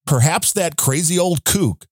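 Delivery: ordinary talk, nothing marked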